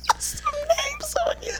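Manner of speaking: laughing whisper; breathy callback through laughter. Real, not acted.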